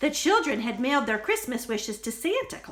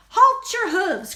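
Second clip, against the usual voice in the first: loud, higher command to halt; cried out; 8 semitones higher, 7 dB louder than usual. Acted. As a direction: call out like a guard elf. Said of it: "cried an elf".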